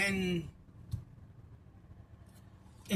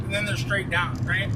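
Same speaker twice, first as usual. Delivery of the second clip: normal talking voice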